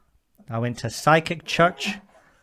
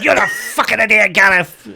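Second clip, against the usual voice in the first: loud, higher furious outburst; angry shout; 8 semitones higher, 10 dB louder than usual. Retold or imitated. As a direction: yell it out, shaken and furious at your son. Mum yells after the scare.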